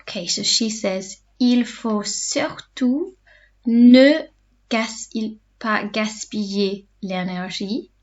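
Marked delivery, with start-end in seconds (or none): 1.4-7.9 slow, halting dictation pace; slow teacher repeat; said slowly. Shown as a demonstration. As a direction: draw each word out for the class. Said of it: "say them slowly in between".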